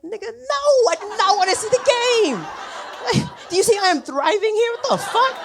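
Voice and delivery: loud, very high-pitched mock outrage; outraged refusal. Refusing the friend's man-to-man rescue, hammed-up outrage. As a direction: shriek the refusal in comic indignation.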